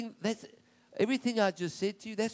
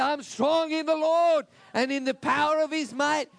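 higher and louder proclamation; ringing affirmation of strength; loud declaration; 6 semitones higher, 6 dB louder than usual. Acted. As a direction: shout the declaration with conviction. Preacher declares loudly.

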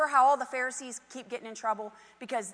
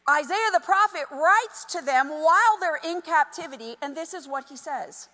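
ordinary talk

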